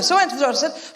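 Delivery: ordinary talk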